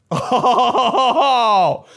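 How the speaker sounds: high little laughing oh of shock; startled laugh. Retold or imitated. Hearing your recorded voice for the first time, laughing surprise. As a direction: react to hearing your own voice with a laughing gasp. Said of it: "You were like".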